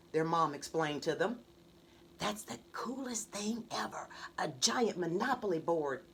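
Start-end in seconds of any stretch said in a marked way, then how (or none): 2.2-6.1 whispered awe; under his breath. Acted. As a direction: breathe it to himself. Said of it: "said under his breath".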